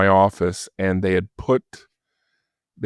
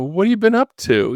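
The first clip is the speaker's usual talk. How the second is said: mock-innocent needling; 12 semitones higher than usual. Caller needling Dave.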